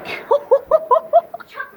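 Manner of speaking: cracking up at the fireworks; high laugh. Real, not acted. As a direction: burst of high-pitched laughter.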